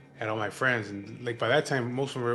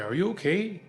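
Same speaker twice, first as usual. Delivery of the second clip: higher-pitched questioning voice; concerned wife; 8 semitones higher, 4 dB louder than usual. Retold or imitated. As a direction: imitate a worried wife checking on him. Wife checks on him.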